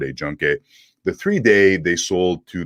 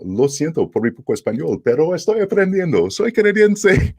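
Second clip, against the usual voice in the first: put-on halting phrasebook Spanish with a French-English accent, climbing much higher; 10 semitones higher, 3 dB louder than usual. Comic telling his cruise audience he's learning Spanish, French-English accent. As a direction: re-enact memorized Spanish in a French-English accent, pitch soaring. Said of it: "the French-English accent".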